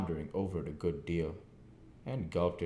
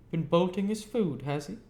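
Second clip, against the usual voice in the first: high, loud shrill-tempered outcry; an angry wife crying out in alarm; sister crying out; 12 semitones higher, 7 dB louder than usual. A narrator's reading. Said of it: "cried my sister".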